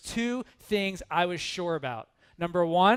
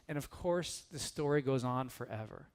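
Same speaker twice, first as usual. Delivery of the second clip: usual speaking voice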